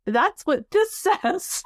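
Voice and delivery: laughing. Real, not acted. Answering husband, laughing.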